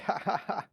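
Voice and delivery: spelled-out fake laugh, ha ha ha. Player humouring drinking guards with a terrible joke, fake laugh. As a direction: force a hollow laugh after a weak joke. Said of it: "It's a fake laugh".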